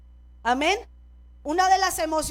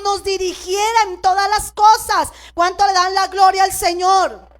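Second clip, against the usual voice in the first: louder, driving sermon delivery; full-force preaching; 2 semitones higher, 4 dB louder than usual. Acted. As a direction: preach it out to the congregation.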